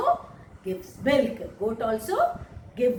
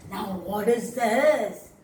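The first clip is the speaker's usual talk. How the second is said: normal talking voice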